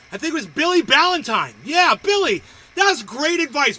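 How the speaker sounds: loud, high-pitched hearty praise; excited shout-out. Real, not acted. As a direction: call out your thanks with gusto.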